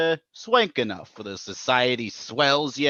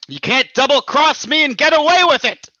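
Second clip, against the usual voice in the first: much higher, louder, yelled warning; furious shout; 8 dB louder, faster than usual. Acted. Abbott yells at the Raja at the peak of the quarrel, furious. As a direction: shout him down at full force.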